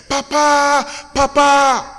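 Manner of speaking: put-on high, eager child's call; child calling papa. Schoolchildren running to their fathers, calling high and eager. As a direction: call out like a little boy running to his dad.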